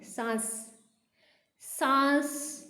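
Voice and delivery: सांस said slowly, the vowel through the nose; very slow, nasal सांस; nasal vowel demo. Shown as a demonstration. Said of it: "सांस के अंदर भी हमें ने की सी ध्वनि आ".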